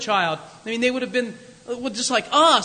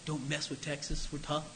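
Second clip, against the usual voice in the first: low, soft mock-tough Texan; mock Texan bravado, quiet; 7 semitones lower, 13 dB quieter than usual. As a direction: growl it like a Texan, softly. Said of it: "people from Texas say".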